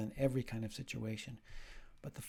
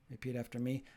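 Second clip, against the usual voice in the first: ordinary talk, nothing marked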